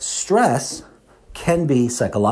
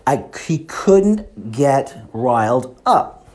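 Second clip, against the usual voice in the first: ordinary talk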